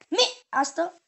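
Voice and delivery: loud order breaking off the explanation; sharp command. Real, not acted. Owner stopping puppy mid-video, sharp loud command. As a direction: cut in firmly and loudly.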